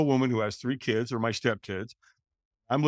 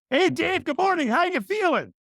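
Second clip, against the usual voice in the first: much higher, louder, chirpy morning greeting; cheerful sponsor; 5 dB louder than usual. Retold or imitated. Sober AA member John phoning Dave at eight, bright and cheerful. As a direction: imitate an upbeat sober guy calling early. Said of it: "he's a real cheerful guy".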